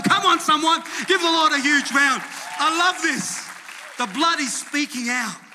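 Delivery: high, loud rallying shout; rousing preacher. Real, not acted. Preacher urging congregation to applaud the Lord, shouting rousingly. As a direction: whip the crowd up into applause.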